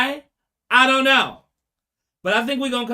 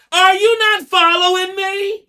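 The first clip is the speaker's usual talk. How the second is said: high, loud, slowed angry demand; anger; 10 semitones higher, 7 dB louder, slower than usual. Pretend. Anger in the mother's head snapping at the court, loud and slow. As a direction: snap at them as the emotion Anger. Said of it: "That's anger".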